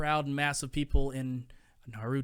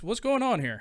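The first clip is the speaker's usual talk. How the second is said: higher, louder wary challenge; the dad puffs up and demands answers; dad acting tough; 9 semitones higher, 6 dB louder than usual. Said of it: "And he's like".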